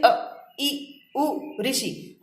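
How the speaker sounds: अ, ई, उ, ऋषि said short and clipped; short vowels said clearly. Shown as a demonstration. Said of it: "कैसे उच्चारण करेंगे?". (लघु स्वरों का साफ़ उच्चारण)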